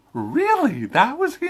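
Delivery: high, disbelieving "Really?"; stunned surprise. Retold or imitated. Critic recalling spotting the actor in the credits, amazed. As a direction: gasp it out like the credits just shocked you. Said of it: "I went".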